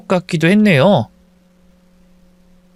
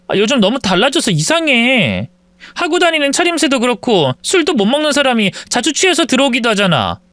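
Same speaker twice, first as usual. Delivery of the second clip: suspicious wife; 7 semitones higher than usual. Acted.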